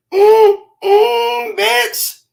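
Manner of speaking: high moaning mm, then a punchline; food ecstasy. Acted. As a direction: moan with delight over the bite. Pitching the pizza.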